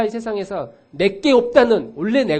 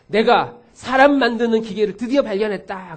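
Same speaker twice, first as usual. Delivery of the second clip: gleeful boast; 4 semitones higher, 6 dB louder than usual. Retold or imitated. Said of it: "즐거워하는 거예요".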